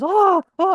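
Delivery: high, loud mock moaning; imitated sex moans. Storyteller mimics woman in dorm bunk, loud mock moans.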